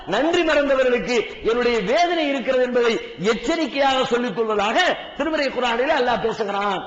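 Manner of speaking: ordinary delivery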